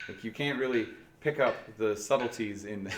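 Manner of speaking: ordinary delivery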